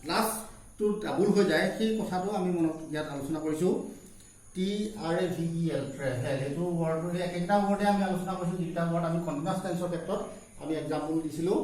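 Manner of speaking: usual speaking voice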